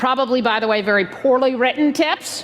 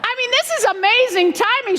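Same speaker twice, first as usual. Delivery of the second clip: high-pitched mock astonishment; hammed-up wonder; feigned amazement; 11 semitones higher than usual. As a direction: play up the coincidence, voice pitched way up. Plays up the coincidence.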